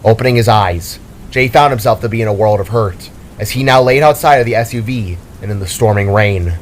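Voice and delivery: ordinary delivery, nothing marked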